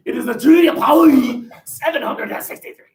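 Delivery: "Paris" as "Pah-lee", loud and high in a hammed-up French accent; mock French Paris. Shown as a demonstration. Teacher hams up French accent for the class. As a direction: belt "Treaty of Paris" in an over-the-top French accent. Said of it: "you must say it like that".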